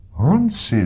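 startled call, a touch of disbelief; surprised exclamation. Acted. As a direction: cry out the name in surprise. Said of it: "zvolal jsem".

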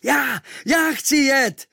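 high, loud, drawn-out eager call; volunteer at the top of your voice; shouting at full lungs. A narrator's reading. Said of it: "volal jsem z plných plic". (volání z plných plic)

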